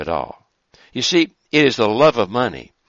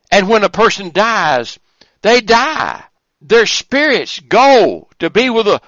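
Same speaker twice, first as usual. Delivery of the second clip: higher and louder, driving the point home; fervent preaching; 9 semitones higher, 5 dB louder than usual. Acted. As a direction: preach it with rising fire. Preaching rises to full force.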